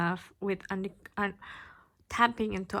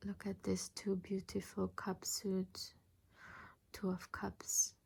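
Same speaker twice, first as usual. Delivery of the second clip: whispered, softer, in awe of the card; admiring whisper; 10 dB quieter than usual. Real, not acted. Reader showing a beautiful card to viewers, awed whisper. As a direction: breathe it out in quiet wonder.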